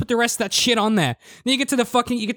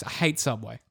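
ordinary talk

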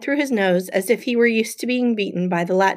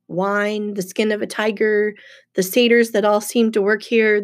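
ordinary delivery, nothing marked

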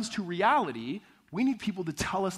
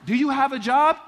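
higher, louder mock date question; playfully acted, raised-voice question; dating question; 8 semitones higher, 9 dB louder than usual. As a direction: put the question brightly to a date, pitched up. Acted question between dating couple.

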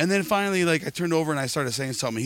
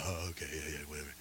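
a low, much softer throwaway yeah whatever; dismissive mumble; 7 semitones lower, 16 dB quieter, faster than usual. Retold or imitated. Bald guy in the crowd shrugging him off, mumbled and dismissive. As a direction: brush it off under your breath.

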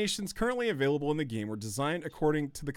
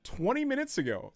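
usual speaking voice

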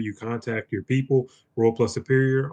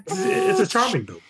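squeaky high voice. Acted. High comic retort.

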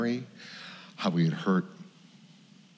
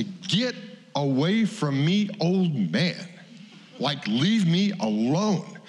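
higher, slow, exasperated brush-off; mock irritation; 8 semitones higher, much slower than usual. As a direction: push the old man away, half-joking. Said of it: "And I wanna say".

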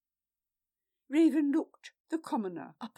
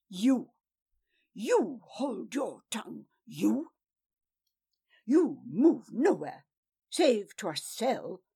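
loud, furious orders; angry command; 6 dB louder than usual. A narrator's reading. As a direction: order him jailed, loud. Eurolf ordering arrest.

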